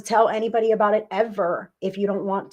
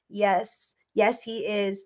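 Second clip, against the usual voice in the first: ordinary talk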